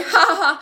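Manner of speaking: loud, high ha-ha-ha; acted hearty trash can laugh; booming laugh. A narrator's reading. Trash can laughing at its own name, loud acted laugh. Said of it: "e rideva".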